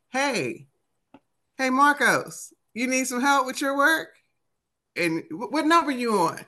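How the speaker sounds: put-on high, eager kid calling out; calling out to a classmate. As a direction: sing out across the room to help.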